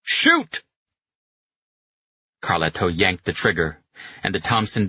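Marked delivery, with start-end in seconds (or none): 0.0-0.6 one explosive, high-pitched yell; shouted command. A narrator's reading. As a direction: scream the order to fire. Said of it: "hit the accelerator again and shouted".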